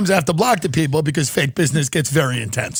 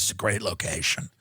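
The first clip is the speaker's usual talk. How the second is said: low mumbled realtor; 12 semitones lower, 11 dB quieter than usual. Retold or imitated. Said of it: "oh, he goes".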